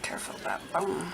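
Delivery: careful whisper. Real, not acted. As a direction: whisper it while carving. Carving around the bone.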